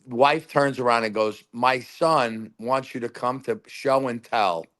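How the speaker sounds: ordinary talk, nothing marked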